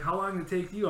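a touch of a nosy fellow hiker; mock curious couple. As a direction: ask it like a keen hiker. Couple asks about the hike.

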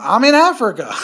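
high-pitched comedian impression; Dave Chappelle. Podcaster quoting the comedian's Africa story to a co-host, amateur impression. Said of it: "is that a good Dave Chappelle".

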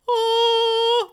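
high, loud heavenly "Oh"; angelic voice. Retold or imitated. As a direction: imitate an angelic choir note. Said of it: "your angelic voice".

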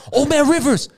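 high, loud cry of grief; anguished cry. Retold or imitated. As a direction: cry out for a fallen friend. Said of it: "He goes like".

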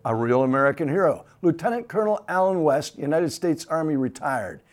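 TV host intro. Acted. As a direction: introduce the war hero with ceremony. Host reads formal guest intro.

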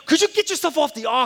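loud, high, exasperated demand; retold yelling at daughter. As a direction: bark it at the kid hogging the aux. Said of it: "I start yelling at her".

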